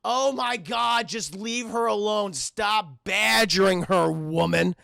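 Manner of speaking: slow, drawn-out exasperation; exasperated father. Retold or imitated. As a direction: tell off the nagging wife, fed up. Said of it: "the father's just like".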